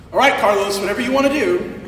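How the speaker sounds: usual speaking voice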